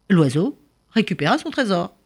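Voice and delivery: ordinary delivery, nothing marked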